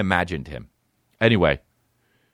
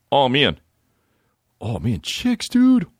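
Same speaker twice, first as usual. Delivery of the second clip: excitable dude voice rising high; buddy impression; 8 semitones higher, much slower than usual. Buddy excited about chicks, hooting dude impression. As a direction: end on a very high hoot. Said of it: "he'd say like that".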